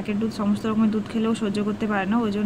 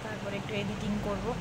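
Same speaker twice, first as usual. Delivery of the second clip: speaking softly; 12 dB quieter than usual. Real, not acted. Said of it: "আমাকে একটু আস্তে আস্তে কথা বলতে হচ্ছে". (ঘুমন্ত বাচ্চার পাশে খুব আস্তে কথা বলা)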